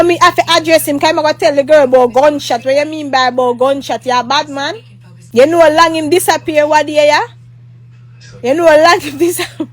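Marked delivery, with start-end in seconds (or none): none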